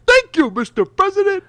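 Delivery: high, loud cartoon squeal; Ed voice impression. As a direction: squeak it out like a goofy cartoon kid. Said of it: "the only phrase I can say in his voice".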